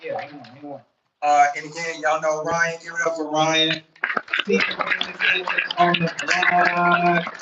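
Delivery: hype MC intro. Acted. Hypes the panel crowd.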